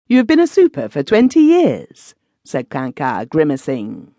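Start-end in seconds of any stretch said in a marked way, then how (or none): 0.1-2.1 shocked disbelief. Acted. Comedian to former executioner, shocked and incredulous. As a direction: pitch jumps much higher and louder in disbelief.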